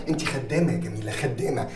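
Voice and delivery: low, quiet contempt; scornful put-down. Acted. Owner belittling his engineer in front of her guest, low and scornful. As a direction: sneer it down at her softly. Boss mocks the employee as servant.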